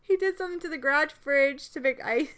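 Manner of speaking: high, loud laughing through tears; crying while laughing. Real, not acted. Laugh-crying about her dad.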